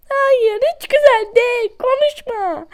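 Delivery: high, loud indignant no; indignant protest. Real, not acted. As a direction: shoot it down, outraged and shrill-high. Protests game's "bu çok güzel.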